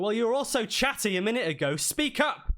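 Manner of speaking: high, loud mocking call to the silent crowd; taunting NPC. NPC taunts the hushed crowd, high and loud.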